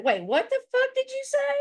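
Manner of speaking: mock shocked. Imitating PDX shocked at what John said. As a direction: squeak it out in disbelief. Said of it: "she's like".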